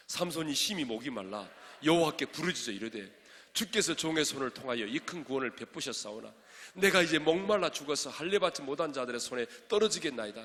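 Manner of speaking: loud, declaimed Bible verse; forceful scripture reading. Acted. Preacher leading congregation in Samson's prayer verse, forceful reading. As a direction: read the verse out with full pulpit force.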